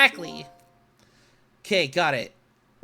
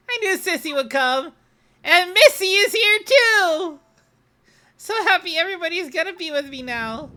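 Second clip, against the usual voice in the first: happy Camila; 8 semitones higher, 5 dB louder than usual. Acted.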